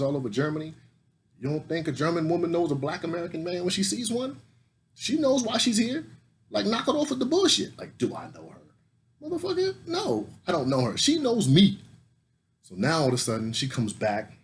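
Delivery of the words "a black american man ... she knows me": much higher, heated bragging; fired-up rant. Real, not acted. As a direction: hype it up, pitch climbing. Boasting rant at colleague.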